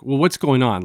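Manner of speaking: normal talking voice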